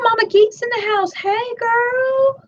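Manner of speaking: light up for a friend arriving; excited greeting. Real, not acted. Greeting a viewer who just joined the stream, high and excited.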